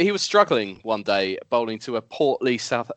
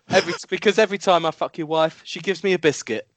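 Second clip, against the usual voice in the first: put-on batsman's retort in a light Zimbabwean accent, pitched higher; 7 semitones higher, faster than usual. Batsman answering the bowler in a light Zimbabwean accent. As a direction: toss back the comeback in a hinted Zimbabwean accent. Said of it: "That's accent related".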